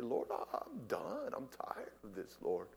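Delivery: shifting between whisper and loud pleading; fervent whispered-to-cried prayer; modeled prayer. Acted. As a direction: pray it out as a surrendering sinner. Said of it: "God wants us to, just by his grace, say".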